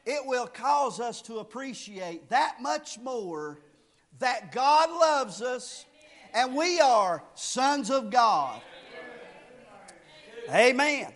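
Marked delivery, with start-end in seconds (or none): none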